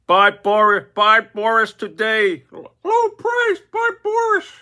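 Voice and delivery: high squawky parrot hawking himself, slow; high parrot sales squawk. Acted. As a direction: squawk it like a pet-shop parrot selling himself. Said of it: "here he goes".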